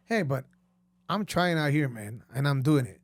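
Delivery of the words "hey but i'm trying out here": usual speaking voice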